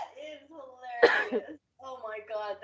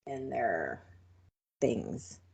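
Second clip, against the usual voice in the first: low, quiet coy murmur; 13 semitones lower, 11 dB quieter, much slower than usual. Real, not acted. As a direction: drop low and soft for their things. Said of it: "trailing coy".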